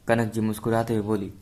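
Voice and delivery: plain reading voice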